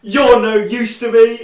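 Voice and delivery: loud, high, slow mimicked dismissal; woman's villain line. Woman quoted before shooting the mother, mock-villain delivery. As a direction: act out a hammed-up villain's verdict. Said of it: "this woman says".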